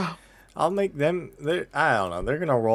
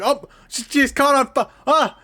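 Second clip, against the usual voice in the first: much higher, louder panicked cry; panicking guards; 10 semitones higher, 3 dB louder than usual. Acted. Guards panic as the bard catches fire, high frantic cry. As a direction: yelp it as guards see a woman on fire. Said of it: "they begin panicking, like".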